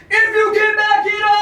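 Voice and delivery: loud, high bragging shout; a full hammed-up shout of the shirt's slogan. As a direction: yell the slogan like a challenge. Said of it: "That's how I read it".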